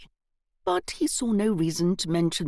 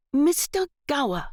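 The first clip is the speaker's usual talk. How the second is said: exaggerated patience; 8 semitones higher, 4 dB louder than usual. A narrator's reading. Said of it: "Evelyn said with exaggerated patience".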